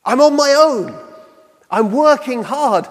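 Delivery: mildly voiced complaint to herself; lightly acted grumble; frustrated [name]. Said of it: "starting to make a little bit more noise".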